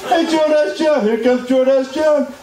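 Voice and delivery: high, sing-song schoolyard taunt; mocking bully taunt. Bully mocking him in the school hallway, high taunting voice. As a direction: jeer the nickname like a kid bully. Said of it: "They used to call me Jordache John".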